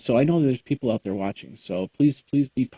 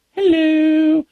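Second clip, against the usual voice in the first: silly high hello; 6 dB louder than usual. Pretend. Mock greeting in odd voice.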